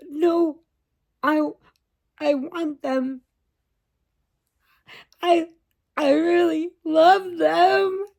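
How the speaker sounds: put-on high, slow, teary child voice; a little girl sobbing that she loves them; mock tearful little girl; crying child. Crying child voiced in retold story.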